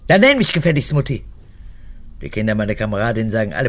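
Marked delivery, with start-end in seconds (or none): 0.1-1.2 fast, louder demand, snapped out; sharp command. Acted. Mother orders her daughter to say Mutti, sharp and loud. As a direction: bark the order at her like a drill sergeant.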